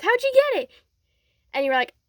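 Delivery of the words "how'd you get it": high, loud, excited outburst; amazed question. Retold or imitated. Deku asking how you got the rare gift, amazed.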